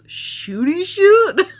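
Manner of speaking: high drawn-out questioning repeat; Pete's puzzled echo. Retold or imitated. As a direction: echo the phrase back as if it's absurd. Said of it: "then Pete goes".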